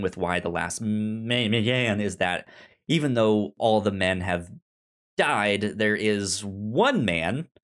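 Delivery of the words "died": normal talking voice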